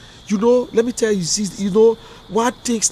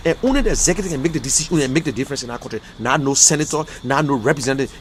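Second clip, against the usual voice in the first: ordinary talk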